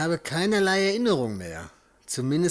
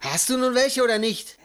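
much higher and louder, stress on "welche"; impatient demand; 10 semitones higher, 5 dB louder than usual. Acted. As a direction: snap the question at them.

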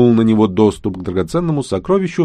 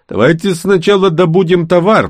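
high, louder character voice; raised, insistent pitch; urging suggestion; 9 semitones higher, 5 dB louder than usual. A narrator's reading. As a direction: propose it briskly to the group. Character urges the others in dialogue.

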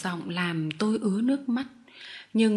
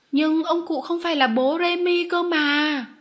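put-on high, raised child's protest; loud protest; 7 semitones higher, 4 dB louder than usual. A narrator's reading. Arthur to his mother about Remy's master, loud high protest. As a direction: cry out in objection like a small boy. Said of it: "Arthur kêu to".

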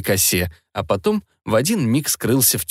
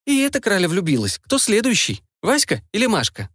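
put-on teasing girl voice; 9 semitones higher than usual. Lilka teases Nastya.

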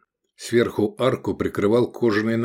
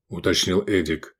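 usual speaking voice

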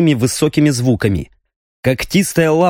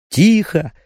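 ordinary delivery

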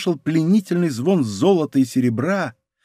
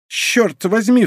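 higher-pitched exclamation; lively outburst; crying out; 6 semitones higher, slower than usual. A narrator's reading. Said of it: "вскричал Планше".

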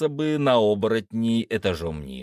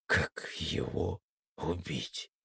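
slow, soft, daunted mumble; mumbling; 5 semitones lower, 9 dB quieter, slower than usual. A narrator's reading. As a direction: mumble it slowly under your breath. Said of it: "только и промямлил я". (тихо и растерянно промямлить)

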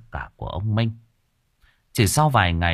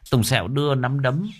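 usual speaking voice

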